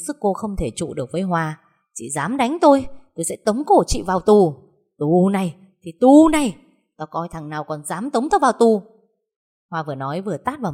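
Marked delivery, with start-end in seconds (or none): 1.9-4.5 high, loud cry of defiance; shouting back. Acted. Sister-in-law yelling at Hoa while being beaten, loud and high. 5.0-8.8 loud, scornful shout-back; defiant yell. Acted. Hoa yelling back at her sister-in-law mid-fight, loud and scornful.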